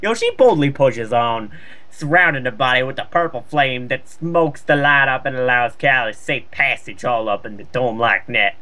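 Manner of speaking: ordinary talk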